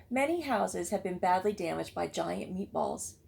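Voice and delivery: plain reading voice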